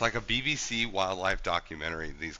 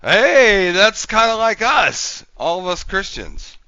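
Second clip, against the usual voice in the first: loud, high and eager; excited; 11 semitones higher, 11 dB louder, slower than usual. Real, not acted. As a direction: blurt it out with glee. Excited joke about Christians.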